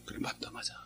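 hushed admission. Retold or imitated. Pastor quoting his reply to son.

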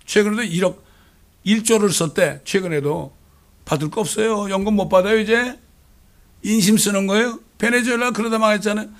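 loud, high outraged preaching; fiery sermon alarm; preaching at full force. Acted. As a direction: stir up alarm in the pews.

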